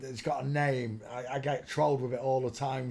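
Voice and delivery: ordinary talk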